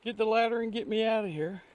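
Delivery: high-pitched mock yell from the bottom of a hole; retold hollering. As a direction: imitate a man stuck down a pit calling for help. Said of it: "And he's hollering".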